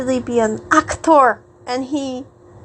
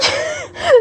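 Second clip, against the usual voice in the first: real squeal of a laugh, much higher. Real, not acted. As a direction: burst into a high giggle. Laugh after acted wail.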